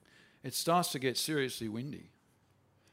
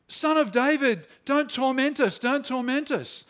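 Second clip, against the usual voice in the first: higher, louder, frantic pleading; possessed man pleading; 8 semitones higher, 7 dB louder than usual. Retold or imitated. The madman begging Jesus, high frantic plea. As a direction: cry out like a tormented man begging.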